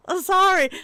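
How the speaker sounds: high, loud, near-crying sorry; teary panicked apology; mortified sorry. Retold or imitated. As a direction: beg forgiveness in a panic. Retold mortified apology, gasping.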